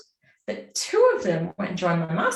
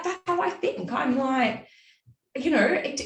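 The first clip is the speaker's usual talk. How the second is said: ordinary delivery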